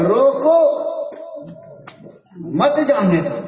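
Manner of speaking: high rising "روکو", long break, then "مت جانے دو"; pause after روکو. Acted. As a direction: hold "روکو" apart from "مت جانے دو". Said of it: "ادھر کا چپراسی پڑھ رہا ہے".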